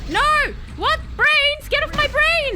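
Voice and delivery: very high, loud panicked shriek; panicked screaming. Real, not acted. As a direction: scream at the attackers to get off. Panics as zombies attack.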